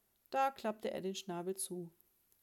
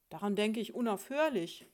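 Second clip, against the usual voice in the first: loud, exasperated comeback; loud retort; 2 semitones higher, 8 dB louder than usual. Acted. Husband answering his wife mid-quarrel, loud retort. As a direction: fire back at your spouse.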